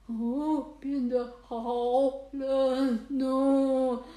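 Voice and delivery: loud shivering cry of cold; freezing cry. Acted. Cats after eating all the ice cream, loud freezing cry. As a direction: burst out how cold it is.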